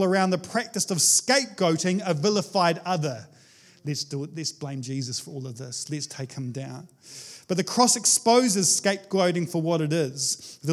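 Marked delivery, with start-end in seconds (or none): 3.8-6.9 low, hushed voice of plotters; conspiratorial undertone. Acted. Scapegoaters agreeing to blame an innocent man, low conspiratorial undertone. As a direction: drop down low and soft, as if quietly scheming.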